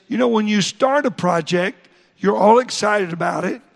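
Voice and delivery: ordinary talk, nothing marked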